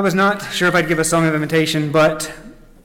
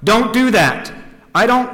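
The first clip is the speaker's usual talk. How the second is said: raised, high-pitched cry of objection; acted, indignant yell; yelled protest; 7 semitones higher, 5 dB louder than usual. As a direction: yell it out as a storybook character. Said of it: "yelled out".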